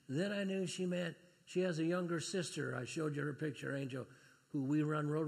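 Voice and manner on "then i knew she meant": ordinary talk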